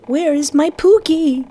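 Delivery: high, worried woman's voice. Acted. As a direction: call out anxiously, high. Woman character searches for husband.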